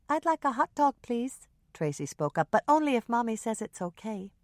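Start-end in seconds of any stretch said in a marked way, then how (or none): 0.1-1.5 put-on high, small child's voice; little girl Tracy. A narrator's reading. Young daughter ordering lunch, in a high child's voice. 2.5-4.3 a hint of a child, a bit higher; light little girl voice. A narrator's reading. Small daughter checking with her mother, lightly childlike.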